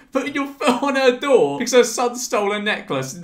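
exasperated and half laughing at the force used; incredulous outrage. Real, not acted. Outraged at foot in door.

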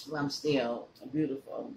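ordinary talk, nothing marked

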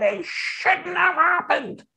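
high, slow, furious shout of the friend; furious outburst. Retold or imitated. Doctor friend insists the blood test shouldn't have happened, furious. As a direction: erupt with great fury, each word hammered. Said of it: "he erupted with great fury".

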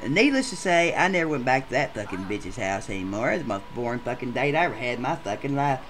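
ordinary talk

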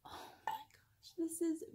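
soft breathless whisper of wonder; awed whisper. Real, not acted. Admiring a fragile figurine, awed whisper. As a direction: whisper it as if afraid to break it.

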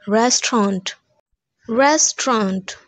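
restaurant as RES-tront; correct pronunciation. Shown as a demonstration. Teacher repeating the right form, correctly pronounced. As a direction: say restaurant with an open second vowel, res-tront. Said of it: "The correct pronunciation is".

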